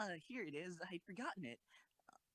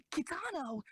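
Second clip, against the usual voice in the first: surprised shout; 12 semitones higher, 4 dB louder than usual. Acted. Recognizing the organizer.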